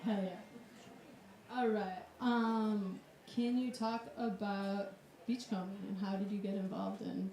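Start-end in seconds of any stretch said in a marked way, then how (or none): none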